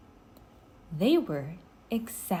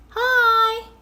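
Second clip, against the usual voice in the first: put-on much higher, loud girlish call; shouted hi; 10 dB louder than usual. A narrator's reading. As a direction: Annie shouts hi to the pteranodon, loud high call. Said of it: "Annie shouted".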